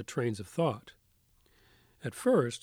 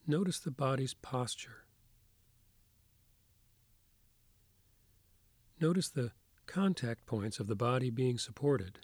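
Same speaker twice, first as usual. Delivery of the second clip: soft spa whisper; 4 dB quieter than usual. Acted. Leads a guided meditation.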